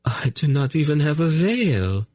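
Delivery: high, fretful lady; a noblewoman fussing; high, anxious courtly plea. Acted. Narina, still faltering.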